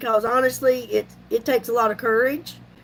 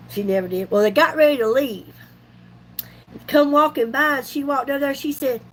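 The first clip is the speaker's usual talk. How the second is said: ordinary delivery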